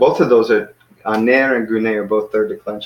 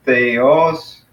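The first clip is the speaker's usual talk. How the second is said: "theos" slowly, split as the-os; syllable by syllable; 2 semitones higher, 3 dB louder than usual. Shown as a demonstration. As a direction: sound out "theos" with the second vowel starting a new syllable. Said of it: "you come up with a new syllable".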